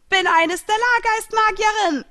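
very high, loud shriek; piercing villain's shout; screamed declaration. A narrator's reading. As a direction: scream the revelation triumphantly. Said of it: "schrie sie hinaus".

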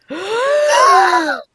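loud high shocked gasp; dramatic gasp. Pretend. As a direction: gasp at the big reveal. Dramatic reveal reaction.

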